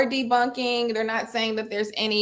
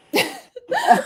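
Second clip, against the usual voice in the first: high burst of laughter; sudden high-pitched laugh; 6 dB louder than usual. Real, not acted. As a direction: break into a squealing laugh. Laughing at her own imitation.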